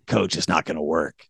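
put-on low, soft kid's objection; subdued, hesitant player voice; quiet doubt. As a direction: quietly break it to the coach. Kid doubts yelling coach's order.

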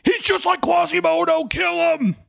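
very high loud shout; mock frenzied crowd yell; mob shout. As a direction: scream it like an angry mob. Imagined audience mob cry.

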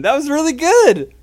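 high, loud, delighted; excited praise. Real, not acted. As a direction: cheer her on gleefully. Praising a real laugh.

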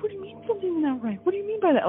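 high, fast, pressing questions; demanding her husband explain himself, alarmed. Retold or imitated.

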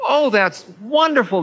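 put-on high, beaming old gentleman; delighted old man. A narrator's reading. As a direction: gush with simple delight.

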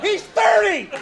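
very high-pitched comic outburst; outraged yell. Acted. Comic outraged at a grown man's order, high yell. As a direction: cry it out in disbelief.

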